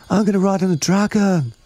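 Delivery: put-on very high-pitched excited child; excited child's cry. A narrator's reading. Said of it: "cried one".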